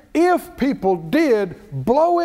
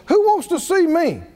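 higher, commanding challenge; God's voice; 6 semitones higher than usual. Acted. Preacher voicing God demanding Job speak, higher and commanding. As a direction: call Job out like a sovereign. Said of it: "God spoke out of it and said".

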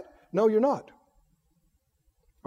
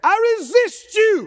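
high-pitched shout of resistance; defiant declaration; 13 semitones higher, 5 dB louder than usual. Shown as a demonstration. Preacher leading congregation in resisting fear, defiant shout. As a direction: shout it as a line to be repeated. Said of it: "You say".

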